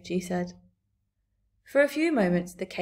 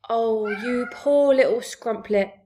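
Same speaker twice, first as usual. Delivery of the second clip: slow, louder giant's exclamation; crying out in pity; 3 semitones higher, 6 dB louder, slower than usual. A narrator's reading. BFG pities orphaned Sophie, slow pitying cry. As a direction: exclaim with drawn-out sympathy. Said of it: "cried the B F G".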